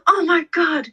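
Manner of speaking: high, thrilled gasp of realisation; excited oh my God. Retold or imitated. Speaker recalling her thrilled reaction, high and excited. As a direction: burst out with sudden delight. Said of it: "I was like".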